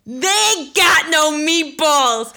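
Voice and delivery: drawn-out, outraged mock scream; loud, high comic holler of outrage; screaming dad. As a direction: bellow it slowly like an appalled dad. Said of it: "He's screaming".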